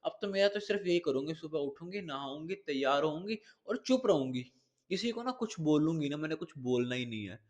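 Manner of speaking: soft mock sulk, promising to stay silent; mock Tanya vow. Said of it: "इसने बोला कि".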